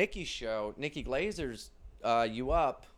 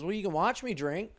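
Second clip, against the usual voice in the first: higher, matter-of-fact dopey offer; imitating buddy; 8 semitones higher, 3 dB louder than usual. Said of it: "He goes".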